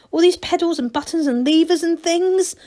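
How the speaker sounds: ordinary delivery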